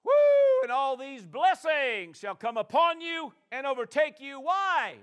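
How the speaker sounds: loud, high woo then slow reading; excited whoop. Acted. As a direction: celebrate the blessings aloud. Exclaims over blessings.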